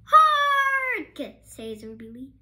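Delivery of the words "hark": high, loud proclaiming hero cry; theatrical herald's shout; heroic call. A narrator's reading. Ruby Lee's heroic call.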